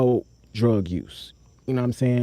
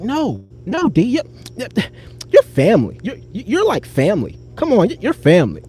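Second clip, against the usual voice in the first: imitating Sarah insisting; 6 semitones higher than usual. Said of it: "So she like".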